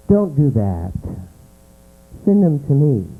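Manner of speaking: usual speaking voice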